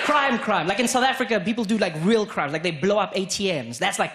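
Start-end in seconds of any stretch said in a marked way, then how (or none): none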